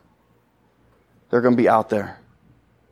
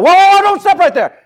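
high, loud alarmed shout of whoa; yelled warning; 7 dB louder than usual. Retold or imitated. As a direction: yell it like someone spotting a mine right under a foot. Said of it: "someone yelled out".

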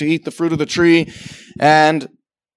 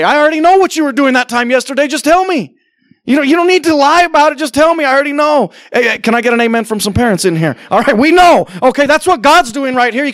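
preaching; 9 semitones higher than usual. Acted. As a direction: drive it home from the pulpit. Preacher at full force again.

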